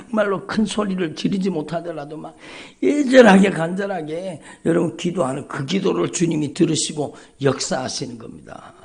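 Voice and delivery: ordinary delivery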